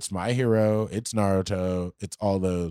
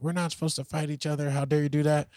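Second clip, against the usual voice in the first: raised-pitch mock yell at no real volume; retold yelling; 7 semitones higher than usual. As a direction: imitate an indignant scolding without really shouting. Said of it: "like, yelling at him".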